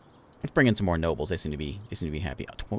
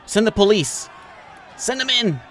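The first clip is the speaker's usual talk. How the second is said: excited shout; 12 semitones higher, 4 dB louder than usual. Real, not acted. Ordering police in game.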